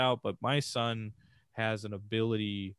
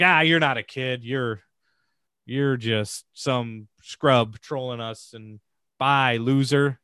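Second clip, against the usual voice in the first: loud sneering gamer taunt; scornful yell of a toxic player; mocking troll; 3 semitones higher, 7 dB louder, slower than usual. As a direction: jeer at him like an online troll. Trolls mocking the kid online.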